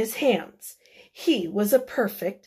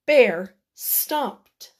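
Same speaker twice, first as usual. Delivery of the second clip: plain reading voice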